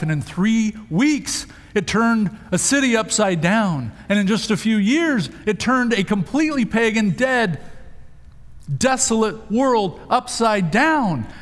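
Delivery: high-pitched, emphatic sermon delivery; impassioned preaching. Real, not acted. Preacher urging the church to be a lighthouse, impassioned.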